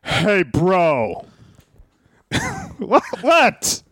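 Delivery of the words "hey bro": drawn-out impression of a wrestling personality; Vince Russo. Host unveiling his take for his co-host, mock impression. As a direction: stretch out the greeting as a mock impression. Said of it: "Here's my take on Vince Russo".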